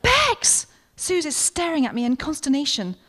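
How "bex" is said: alarmed cry. Acted. Suze alarmed at Becky eating cake.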